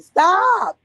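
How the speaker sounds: high, loud yelp of stop; sharp shout. Real, not acted. Shouting at her boyfriend to quit it.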